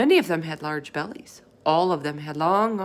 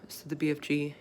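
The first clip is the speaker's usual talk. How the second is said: usual speaking voice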